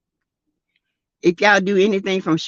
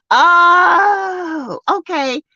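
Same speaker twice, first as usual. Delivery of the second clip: sudden realization; 9 semitones higher, much slower than usual. Real, not acted. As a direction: gasp out the oh as it dawns on you. Realizes she misread the comment.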